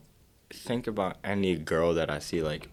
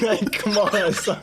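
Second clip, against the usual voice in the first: laughing; 14 semitones higher, 6 dB louder, slower than usual. Real, not acted. Joking about his future son.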